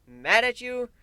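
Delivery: plain reading voice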